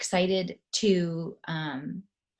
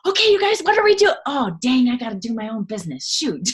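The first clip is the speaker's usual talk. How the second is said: much higher, louder excited yelp then hurried catch; frantic realization; 5 semitones higher, 5 dB louder than usual. Retold or imitated. Busy team leader suddenly remembering her own business, high and frantic. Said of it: "I'm like".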